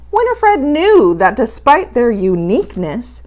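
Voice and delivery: ordinary delivery, nothing marked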